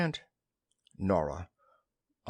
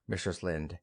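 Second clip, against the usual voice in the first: plain reading voice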